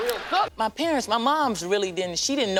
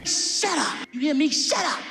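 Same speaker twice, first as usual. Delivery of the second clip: high sharp mock scold; mom scolding; 8 semitones higher, slower than usual. Mother in church telling him to shut up, high and sharp. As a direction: snap it high like an angry mother.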